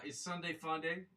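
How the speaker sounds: cheerful slogan. Acted.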